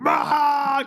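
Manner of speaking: high shrill anime yell; imitating a screaming anime boy; mock scream. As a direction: let out a hammed-up scream. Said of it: "all he does is fucking scream".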